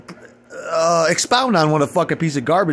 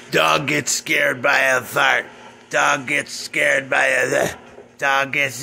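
mocking parody; 5 semitones lower, much slower than usual. Mocking the viewers' fart videos.

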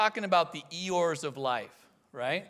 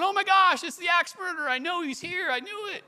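high-pitched mock panic, loud at first; freaking out; 11 semitones higher, 5 dB louder than usual. Horror movie viewer sure the axe murderer is here, freaking out. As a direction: act out a jumpy, freaked-out viewer. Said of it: "you're freaking out, going".

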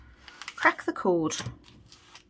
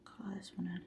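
much softer, whispered to herself; whispered aside; 18 dB quieter, faster than usual. Real, not acted. Saver whispering to herself while colouring the tracker. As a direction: murmur while concentrating on colouring.

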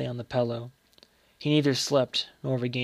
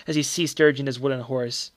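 ordinary delivery, nothing marked

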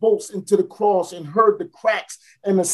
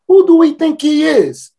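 mocking outburst; 7 semitones higher, 6 dB louder than usual. Fruit seller mocking rumors.

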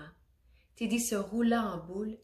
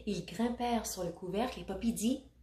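ordinary delivery, nothing marked

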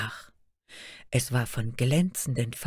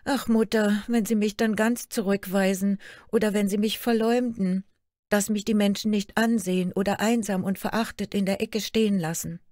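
put-on lightly raised, plaintive young girl's voice; a hurt daughter pouring out her sorrows; young princess Märchen; 6 semitones higher, 3 dB louder than usual. Daughter complains to her queen mother, lightly plaintive girl voice.

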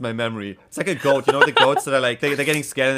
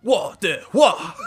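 slow, loud, high "我的花", mock-tearful; theatrical mock wail; overacted delivery; 6 semitones higher, 5 dB louder, much slower than usual. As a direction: ham up "我的花" in drawn-out syllables. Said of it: "I gotta commit harder".

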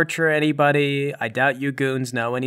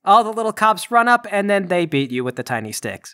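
higher, lively game master voice; dramatic action narration; 7 semitones higher than usual. A narrator's reading. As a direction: narrate the beating with relish.

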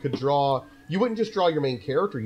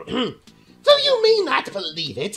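slow then high and loud manic villain; cackling madman pitch; Joker voice; 10 semitones higher, 3 dB louder, slower than usual. Acted. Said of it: "do this guy as the Joker".

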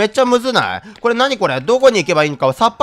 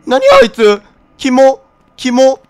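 grossed out; 7 semitones higher, 6 dB louder, slower than usual. Real, not acted. Reacting to creepy figure.

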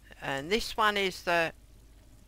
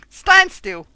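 shouting; 9 semitones higher, 11 dB louder than usual. Real, not acted. Exasperated shouting at player.

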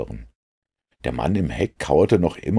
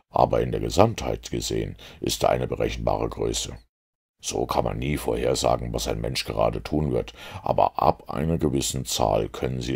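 lightly lowered, measured detective; a hint of the lecturing Holmes; Holmes's voice; 5 semitones lower, 3 dB quieter than usual. A narrator's reading. Holmes explains statistics to Watson, lightly lower.

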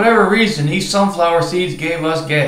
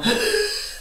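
a sudden high gasp, like something is badly wrong; sharp gasp; 6 dB quieter than usual. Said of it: "and starts going".

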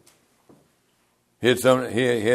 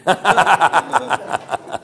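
long chuckling laugh, higher than speech; real laugh; 5 semitones higher than usual. Real, not acted. Preacher amused by his McDonald's story, real laughter. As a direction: laugh heartily at your own story.

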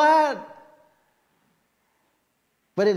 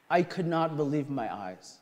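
ordinary talk

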